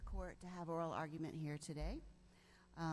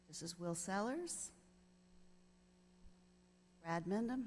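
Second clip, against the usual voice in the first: ordinary talk, nothing marked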